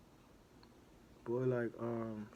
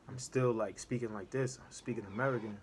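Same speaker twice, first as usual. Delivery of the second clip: normal talking voice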